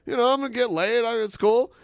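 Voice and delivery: high excited dude voice; eager bro impression; imitating a dude. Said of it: "and he's like".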